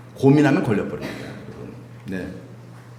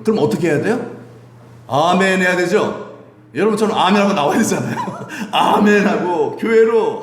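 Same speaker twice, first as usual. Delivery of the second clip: normal talking voice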